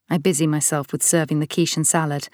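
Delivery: ordinary delivery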